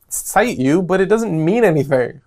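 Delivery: ordinary talk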